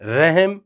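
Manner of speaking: رحم with the vowel leaned, as rehm; رحم, the Urdu way; correct pronunciation. Shown as a demonstration. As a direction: say رحم with a softened rehm sound. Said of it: "رحم نہیں".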